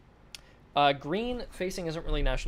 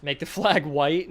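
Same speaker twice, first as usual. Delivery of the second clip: laughing joke; 3 semitones higher, 7 dB louder, slower than usual. Real, not acted. Joking suggestion, laughs mid-line.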